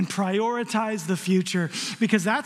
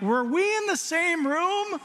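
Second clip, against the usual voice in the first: slow, pitched-up disbelief; mock bewildered parent; incredulous; 7 semitones higher than usual. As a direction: ask it like you can't believe it. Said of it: "I'm going like".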